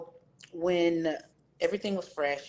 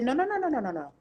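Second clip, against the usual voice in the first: higher, rapid-fire no's to her son; emphatic refusal; 8 semitones higher, faster than usual. Retold or imitated. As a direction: shut the idea down fast. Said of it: "I said".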